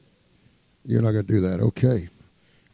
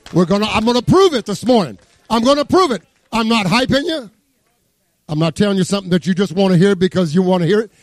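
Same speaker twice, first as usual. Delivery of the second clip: higher, fervent preacher's climb; preaching hard; 6 semitones higher than usual. Acted. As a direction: push the promise home to the church. Pastor preaching at full force.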